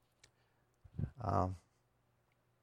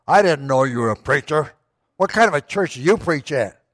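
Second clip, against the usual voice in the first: a hint of a gruff cook, louder; rough questioning tone; gruff diner cook; 7 dB louder than usual. Diner cook questioning the preacher, louder mildly gruff voice. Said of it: "sort of gruffly".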